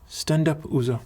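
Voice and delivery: lightly voiced woman, pitch lifted; a gentle instruction in a hint of an accented matron's voice. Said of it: "walks up to you and says".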